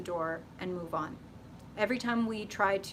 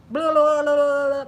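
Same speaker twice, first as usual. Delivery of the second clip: shouted blah blah, much louder; loud, pitched-up nonsense rant; loud mock blabbing; 6 semitones higher, 12 dB louder than usual. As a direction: dump it all out loudly, dismissive. Mock venting at an ex.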